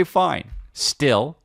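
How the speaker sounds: ordinary talk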